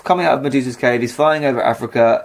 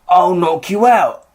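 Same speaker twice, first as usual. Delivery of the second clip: hardman threat; 2 semitones higher than usual. Pretend. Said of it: "Atlas threatens Perseus".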